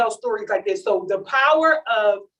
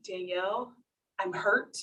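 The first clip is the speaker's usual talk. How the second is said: softer, hurt mother's voice; quiet hurt mom; 4 semitones lower, 11 dB quieter than usual. Retold or imitated. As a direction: say it small and wounded. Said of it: "And she says".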